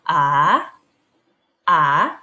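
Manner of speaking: A sliding upward from low; tone two demo. Shown as a demonstration. Teacher modelling rising A for the class. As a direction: let A rise like a question. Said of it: "Cara bacanya adalah".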